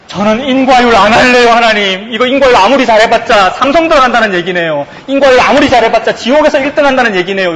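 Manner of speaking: lightly voiced earnest confession; confessing believer. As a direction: cry it out to God. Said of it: "라고 고백한 거죠".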